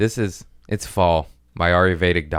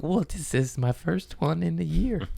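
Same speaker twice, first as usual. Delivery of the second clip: higher sheepish reply; 10 semitones higher, 4 dB quieter than usual. Retold or imitated. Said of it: "I'm like".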